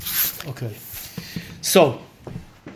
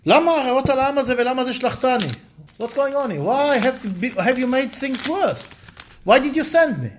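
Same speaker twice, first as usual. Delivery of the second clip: raised pitch, pleading challenge to God; indignant protest; 7 semitones higher, faster than usual. Retold or imitated. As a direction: demand answers from God, high and urgent.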